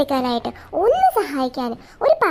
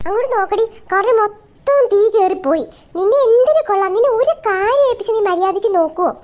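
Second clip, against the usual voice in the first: loud, high furious mother yelling at her daughter; angry scolding; 5 semitones higher, 6 dB louder than usual. Acted. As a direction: shout her awake and scold her hard. Mother scolding daughter for burnt curry. (കോപത്തോടെ ഉച്ചത്തിൽ ശകാരിക്കുന്ന അമ്മ)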